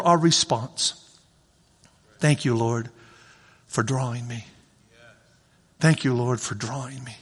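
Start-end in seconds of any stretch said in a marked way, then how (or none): 2.2-7.2 low, soft thanks; grateful prayer. Acted. Believer thanking the Lord, low and soft. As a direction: murmur it with quiet gratitude.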